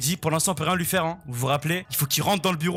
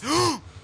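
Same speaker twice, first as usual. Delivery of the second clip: high sharp intake of breath; frightened gasp; sharp startled gasp; 11 semitones higher than usual. Real, not acted. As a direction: gasp as the animatronic appears. Jumpscare in horror game.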